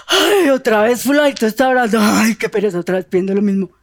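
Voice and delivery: whiny groan, sinking in pitch; mock weariness. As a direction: groan it like someone fed up and lazy. God imagined bored by prayers. (quejándose con pereza fingida)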